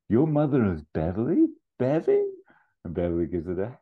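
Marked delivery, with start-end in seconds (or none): 0.1-2.2 high, loud astonished questions; piqued interest. Acted. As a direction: pitch climbs in sudden recognition. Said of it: "his interest pricks up".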